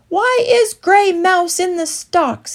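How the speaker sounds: high, loud demand. A narrator's reading. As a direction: insist on an answer. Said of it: "he demanded".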